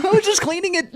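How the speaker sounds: put-on high innocent kid caught out; mock teen excuse. Teen son making excuses to his dad, high giggly voice.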